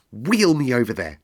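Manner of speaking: put-on a young master giving an order; imperious boy's command. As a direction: snap out the order like a little prince. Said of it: "he commanded".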